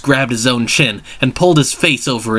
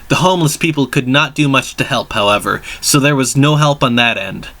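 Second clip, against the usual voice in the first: ordinary delivery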